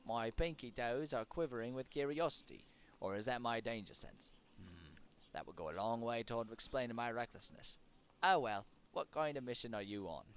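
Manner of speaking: put-on curious rogue in a muddled British-Irish-Australian accent; silly mixed accent. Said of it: "I'm not gonna do that s-, that silly accent anymore".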